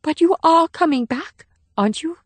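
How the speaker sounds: put-on a touch of child's fear, high; little girl afraid her protector won't come back; fearfully. A narrator's reading. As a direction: plead a little, worried she won't return. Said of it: "said Min fearfully".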